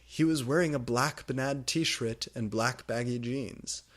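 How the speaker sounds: usual speaking voice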